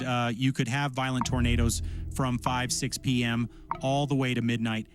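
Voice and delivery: usual speaking voice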